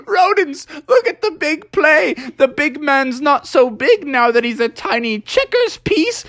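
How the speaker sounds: a high, taunting skunk character; mocking Stinky. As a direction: sneer it out in a high squeaky voice.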